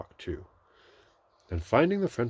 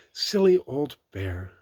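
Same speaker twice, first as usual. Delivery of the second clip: put-on slow, tender and higher, like a fond child; loving voice; 7 semitones higher, 8 dB louder, slower than usual. A narrator's reading. Christopher Robin tenderly to the stuck bear, loving. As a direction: dote on him gently. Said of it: "in such a loving voice".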